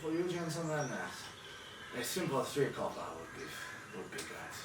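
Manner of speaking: lightly voiced, obliging old fisherman. Fisherman names his price for salmon, halting and polite. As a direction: play a polite, halting river trader.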